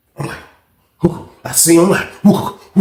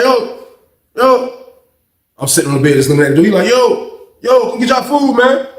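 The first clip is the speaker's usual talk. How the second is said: higher calling voice of kitchen worker; 7 semitones higher than usual. Retold or imitated. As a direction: shout through the door for chow.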